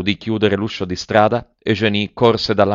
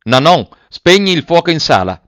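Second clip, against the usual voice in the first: loud, higher barked command; shouted order; 8 semitones higher, 7 dB louder than usual. A narrator's reading. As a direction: call the servant across the house. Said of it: "gridò poi".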